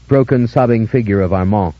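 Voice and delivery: ordinary delivery, nothing marked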